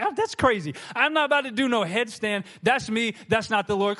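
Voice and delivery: a headstand? no way, in a high squeaky voice; high mock protest. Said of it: "He's like".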